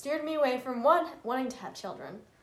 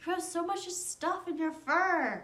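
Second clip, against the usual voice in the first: higher, sing-song voice for a pet; pet talk; 6 semitones higher than usual. Real, not acted. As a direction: coo at the animal. Talking to her pet.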